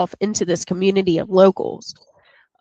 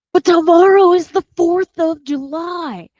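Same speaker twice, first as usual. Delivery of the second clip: high, louder pleading protest; whiny mayor; 10 semitones higher, 7 dB louder than usual. Retold or imitated. Mayor Larry protesting to Brody, high whiny plea. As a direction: whine it like a nervous mayor. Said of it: "And he's like".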